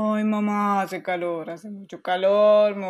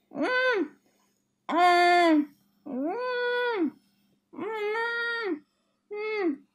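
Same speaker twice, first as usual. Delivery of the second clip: straining grunts; 10 semitones higher, much slower than usual. Acted. Straining in the pool.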